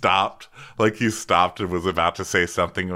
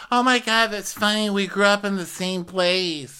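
put-on drunk Terry; 12 semitones higher than usual. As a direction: imitate a sloshed guest gushing. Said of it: "now Terry is wasted".

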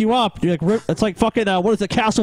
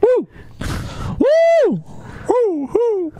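spring break cheer; much slower than usual. Real, not acted. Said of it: "What is it, fucking spring break?".

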